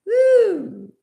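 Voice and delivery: high, loud whoop; a sudden high holler mid-song. Real, not acted. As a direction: let out a joyful holler.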